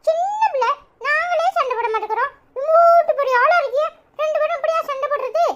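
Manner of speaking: put-on small child's high voice, gently scolding with a giggle; a little girl lecturing grown-ups, sweet but bossy. Little girl telling Anitha and her mother-in-law to stop fighting, high child voice.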